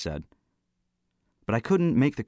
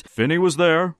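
loud, high, commanding cut-in; commanding interruption; 10 semitones higher, 7 dB louder than usual. A narrator's reading. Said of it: "Brinker interrupted commandingly".

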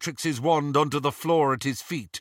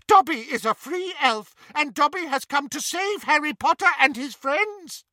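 squealed; 12 semitones higher than usual. Acted. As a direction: squeal it defiantly. Dobby defiant.

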